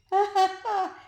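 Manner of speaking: loud, high chortle; old man chortle. A narrator's reading. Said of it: "chortled so hard".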